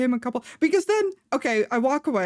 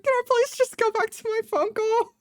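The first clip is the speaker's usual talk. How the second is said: pitched way up, pleading to be left alone; a high, exasperated please-let-me-go; 11 semitones higher than usual. Retold or imitated. Woman begs the pushy stranger to let her return to her call, pitched way up and exasperated.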